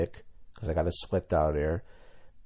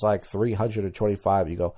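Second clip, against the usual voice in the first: ordinary delivery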